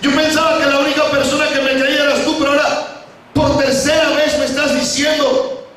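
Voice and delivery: high-pitched, anguished complaint, long drawn phrases; acted lament, climbing in pitch; tearful pleading. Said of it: "comenzó a llorar y dice".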